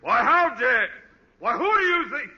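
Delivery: a pompous principal blustering, too outraged to finish; indignant sputtering. Acted. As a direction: splutter in offended fury. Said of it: "Who's this huffing and puffing?".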